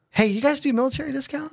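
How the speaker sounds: quick, high mock customer question; imitating customer. Server retells a customer asking for a military discount, high and quick. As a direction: toss it off like the customer. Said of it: "this table goes".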